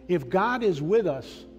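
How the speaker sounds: ordinary delivery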